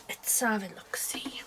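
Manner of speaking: hushed, slow whisper. Real, not acted. Showing her stitching progress to viewers, whispered. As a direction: breathe it out under your breath.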